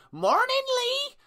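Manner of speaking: high sing-song imitation of a chirpy cleaner; cleaner's greeting. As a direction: mimic her bright daily hello. Cleaner Deb greeting him daily.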